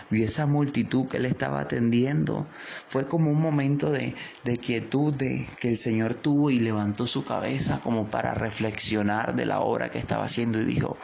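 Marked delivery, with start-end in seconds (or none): none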